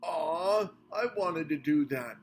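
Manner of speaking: loud disappointed aw; protesting whine. A narrator's reading. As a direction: protest like a let-down kid. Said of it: "protested Minivan".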